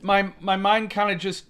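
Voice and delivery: ordinary talk, nothing marked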